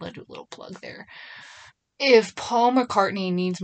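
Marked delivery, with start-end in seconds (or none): 0.0-1.1 whispered, fast, tossed-off plug; a sneaky whispered aside to listeners. Real, not acted. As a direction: slip the plug in under your breath. Quick aside while mentioning album.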